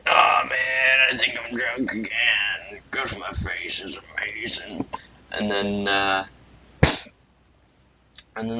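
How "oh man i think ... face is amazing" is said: silly dazed character voice, trailing off; goofy cartoon voice. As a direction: play it goofy and woozy. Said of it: "And he's like".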